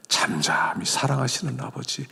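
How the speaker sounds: soft whispered prayerful words; tender whisper. Real, not acted. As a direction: breathe it out tenderly. Tender whispered address to God.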